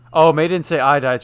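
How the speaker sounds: loud sudden realization; surprised. Real, not acted. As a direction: catch the mistake aloud. Realizing a mistranslation.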